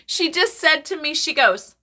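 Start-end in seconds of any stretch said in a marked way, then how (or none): none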